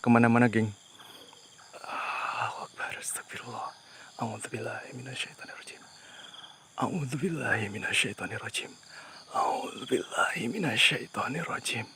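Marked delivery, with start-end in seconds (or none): 1.8-5.8 breathy, very soft real whisper; whispered prayer. Real, not acted. Explorer unable to move seeks protection, whispered prayer. Frozen with fear, prays for protection. 6.8-11.9 recited prayer. Real, not acted. Explorer unable to move seeks protection, softly recited prayer.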